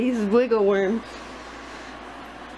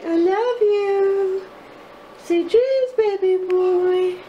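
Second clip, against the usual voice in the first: tender goodnight; 8 semitones higher, slower than usual. Real, not acted. Bedtime goodnight to baby.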